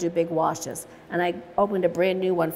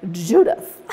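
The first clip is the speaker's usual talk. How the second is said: lightly voiced Julia Child, a touch louder; a hint of a grand TV chef addressing a student; student playfully correcting the teacher, lightly Julia Child; 3 semitones lower, 5 dB louder than usual. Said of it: "in her best Julia Childs voice said".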